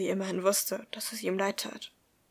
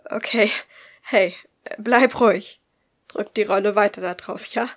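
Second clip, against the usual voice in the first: loud, urgent attempt at composure; trying to sound calm; 9 dB louder than usual. A narrator's reading. Man instructing bleeding woman, forcing himself to be calm. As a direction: instruct firmly, holding back alarm. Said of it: "als wäre er zumindest einigermaßen ruhig".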